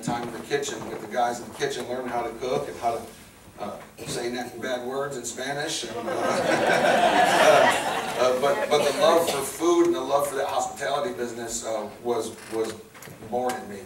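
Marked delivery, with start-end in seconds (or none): none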